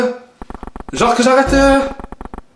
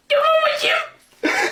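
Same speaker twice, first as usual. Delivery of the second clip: high exasperated wail; 14 semitones higher, 3 dB quieter, much slower than usual. Real, not acted. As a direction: voice shoots up, drawn out. Exasperated at missing sock.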